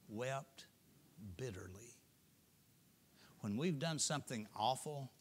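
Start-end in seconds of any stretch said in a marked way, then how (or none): 0.1-2.0 dropped to a whisper. A narrator's reading. Preacher telling Peter wept, whispering the word. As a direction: let the word die in a whisper.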